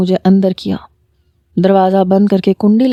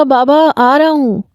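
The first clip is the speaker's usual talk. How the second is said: calling back; 5 semitones higher than usual. A narrator's reading. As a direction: answer your father from inside. Calls back to father.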